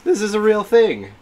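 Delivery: thrilled, voice lifted high; excited disbelief. Real, not acted. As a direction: insist on it in giddy amazement. Repeats it with more excitement.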